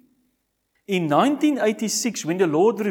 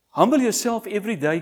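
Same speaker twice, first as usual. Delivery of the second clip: quicker, pressing humble yourself; forceful urging; 4 semitones higher, 6 dB louder, faster than usual. Acted. Preacher pressing the congregation, forceful. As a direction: drive the command home. The appeal said a third time.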